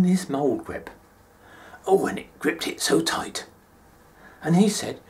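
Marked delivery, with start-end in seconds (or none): none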